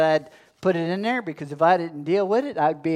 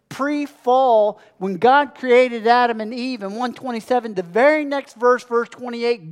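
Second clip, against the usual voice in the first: higher-pitched, emphatic pulpit delivery; impassioned preaching; 5 semitones higher, 3 dB louder than usual. Real, not acted. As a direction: preach it with rising urgency.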